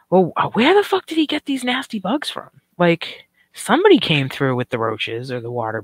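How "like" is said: normal talking voice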